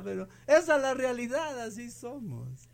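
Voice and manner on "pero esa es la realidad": ordinary talk, nothing marked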